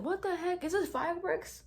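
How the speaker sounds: high, puzzled startled question; startled confusion. Retold or imitated. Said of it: "I'm like".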